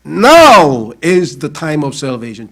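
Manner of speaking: very slow, loud English declaration; solemn proclamation. Real, not acted. Preacher to congregation quoting Corinthians, slow loud proclamation. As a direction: proclaim each word as scripture.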